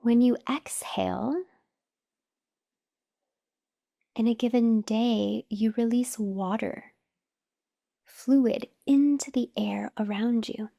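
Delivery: slow, measured meditation voice with long pauses; guided meditation. Acted. Teacher guiding listeners to notice their breath, calm meditation voice. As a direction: guide them unhurriedly through each breath, pausing between phrases.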